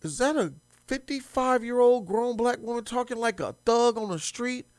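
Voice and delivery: ordinary delivery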